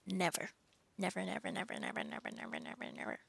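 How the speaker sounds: repeated refusal dwindling into a low mumble; muttered refusal. Real, not acted. Refusing a singing stream request.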